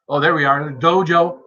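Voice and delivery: normal talking voice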